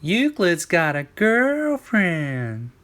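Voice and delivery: high, slow sing-song tease; high drawn-out mocking chant; schoolyard teasing. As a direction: taunt him like playground kids. Friends teasing Euclid.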